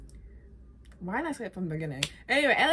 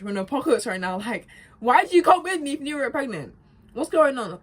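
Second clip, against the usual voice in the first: higher, louder frantic scolding; exasperated Ellie; 6 semitones higher, 8 dB louder than usual. Retold or imitated.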